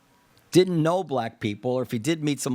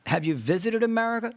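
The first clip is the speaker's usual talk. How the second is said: mildly challenging, raised-pitch questioning; 7 semitones higher than usual. Acted. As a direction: put the question pointedly, pitched up. Host challenges an imagined commentator.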